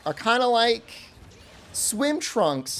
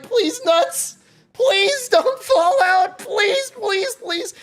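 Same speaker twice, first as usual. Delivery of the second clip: much higher, louder frantic begging; desperate pleading; 13 semitones higher, 5 dB louder, much slower than usual. Retold or imitated. Begging his shorts to hold in front of staring staff, frantic high plea. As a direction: beg with rising panic under your breath. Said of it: "the entire time I'm like".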